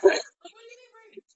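imitated freak-out fading to a squeak; mock panic. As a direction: squeal it faintly in retold alarm. Retells panicking at watch alert.

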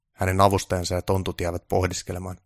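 ordinary delivery, nothing marked

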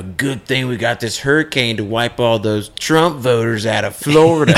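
building to a loud finish; mock ranting. Imitating anti-Trump posters on the hurricane, mock rant.

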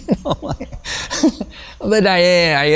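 high-pitched laugh breaking through the words. Real, not acted. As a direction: giggle your way through it. Laughs at his own remark.

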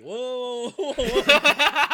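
high, loud laughing whoa. Retold or imitated. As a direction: cry out whoa through laughter. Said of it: "Cheetah was like".

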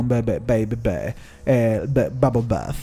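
low, slow, sultry crooning.

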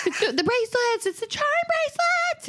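put-on very high, squealing child excitement; very excited kids. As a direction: imitate kids tripping over words with excitement. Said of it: "they're all very excited about it".

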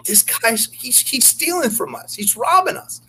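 high, rising mock-female whine; complaining strippers. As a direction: squeak it out like the upset girls. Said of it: "they're like".

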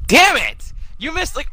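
high, loud real annoyance; high loud curse at the friend who missed it; frustrated outburst. Real, not acted. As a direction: blurt it out, genuinely exasperated. Friend missed the part he wanted.

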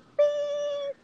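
long, high held beep; one sustained high-pitched "piii"; imitated censor beep. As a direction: bleep out a swear word with your voice. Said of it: "cuando le hace".

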